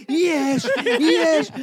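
high, loud mock cheer of "יש"; hammed-up premature goal cheer; mock celebration. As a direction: shout "יש, יש" like a player celebrating too early. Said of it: "ועושה".

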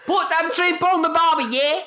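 very high, hammed-up Aussie squawk; Australian accent. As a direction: squeal it in cartoon Australian. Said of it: "I've noticed Australian people".

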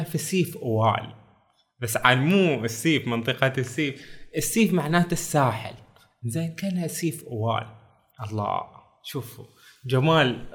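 usual speaking voice

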